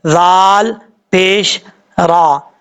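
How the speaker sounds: slow, syllable by syllable; slow spelling out. Shown as a demonstration. Teacher spells the letters slowly for students. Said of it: "ये जो दबाव दे के पढ़ रहे हैं".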